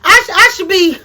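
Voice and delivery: high, fast, heated yell with a laugh in it; half-laughing angry rant; mad yelling. Retold or imitated. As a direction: shout at the boyfriend, fired up. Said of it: "'cause I'm mad".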